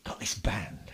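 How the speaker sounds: hushed secretive whisper. Retold or imitated. Band leader's secretive invitation, retold.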